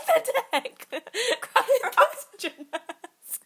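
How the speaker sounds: high, slow, loud panic; panicked yelling. Pretend. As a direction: yell it in mock panic. Mock panic on crashing plane.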